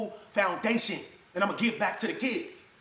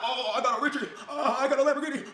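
mock flexing; 6 semitones higher than usual. Mocking a show-off bragging about his new Lamborghini. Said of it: "I'm not flexing when I get it".

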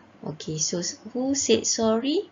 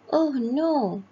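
mild, higher-pitched dismayed exclamation; acted dismay; 5 semitones higher, 4 dB louder than usual. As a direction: voice a character's mild alarm. Story character finds cap missing.

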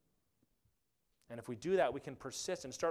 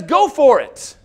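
loud, high mock oracle; mock proclamation; 10 semitones higher, 19 dB louder, slower than usual. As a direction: boom out a made-up word from God. Priest's made-up answer from God.

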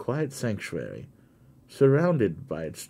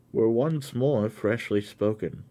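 plain reading voice

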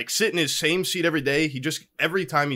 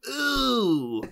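dawning ooh; 7 semitones higher than usual. Retold or imitated. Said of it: "now I'm like".

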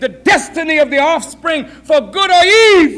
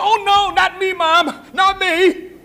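higher-pitched protest; denying daughter; 5 semitones higher than usual. Acted. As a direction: deny it to your mother. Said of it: "Are you pregnant".

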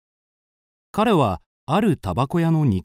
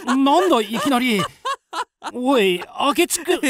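higher, stammering surprise; startled; 8 semitones higher than usual. Acted. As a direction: caught off guard by his laugh. Akechi suddenly laughs.

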